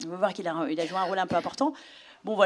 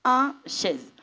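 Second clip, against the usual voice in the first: imitating nanny; 7 dB louder, much slower than usual. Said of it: "la Djiboutienne disait".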